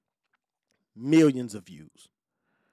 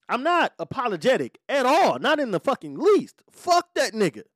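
much higher, louder, heated defiance; real defiant anger; 11 semitones higher, 5 dB louder than usual. Real, not acted. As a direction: refuse to back down, voice climbing. Heated defiance about the fight.

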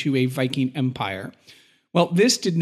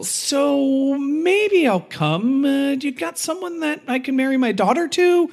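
mock castellan; 10 semitones higher than usual. Castellan bargaining with the king, high and coy. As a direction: play a coy, scheming lord.

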